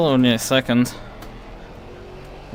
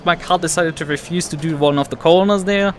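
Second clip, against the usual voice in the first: sarcastic; 5 semitones higher, 7 dB louder than usual. Real, not acted. Mock innocence about losing time.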